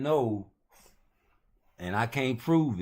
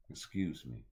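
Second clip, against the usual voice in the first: low and quiet, polite; soft excuse me; 4 semitones lower, 6 dB quieter than usual. Pretend. He politely excuses himself, low and soft. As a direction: murmur it like a small apology. Polite aside, then shouted retake.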